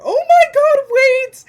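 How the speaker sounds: very high, very loud shriek; excited scream. Real, not acted. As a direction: scream it in pure delight. Reader overwhelmed by the reveal.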